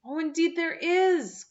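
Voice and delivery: put-on a bright, eager young girl's cry. A narrator's reading. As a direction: cry out in earnest defence. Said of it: "cried Anne".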